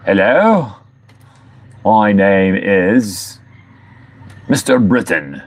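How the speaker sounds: slow, deliberate put-on British accent; mock British accent. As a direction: introduce yourself in hammy British, drawn out. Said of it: "Uh, I'm British".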